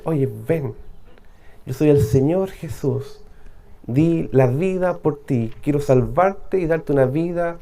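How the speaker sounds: soft, low, tender invitation; gentle divine call. Acted. Preacher voicing the Lord's call to the lost, soft and tender. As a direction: coax softly like a loving voice calling.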